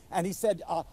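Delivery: ordinary talk, nothing marked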